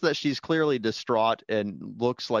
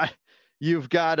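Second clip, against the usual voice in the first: ordinary delivery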